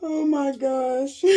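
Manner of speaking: slow, high, tearful laughter; laughing. Real, not acted. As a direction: wheeze it out laughing. Cracking up at own story.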